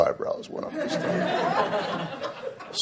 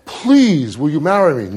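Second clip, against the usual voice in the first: higher, louder mock begging; hammed-up louder plea; 4 semitones higher, 5 dB louder than usual. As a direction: plead like a desperate suitor. Acted proposal, repeated pleading.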